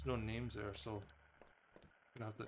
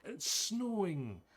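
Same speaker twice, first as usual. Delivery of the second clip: excited; 8 semitones higher, 3 dB louder, much slower than usual. Real, not acted. Exclaiming at the snow.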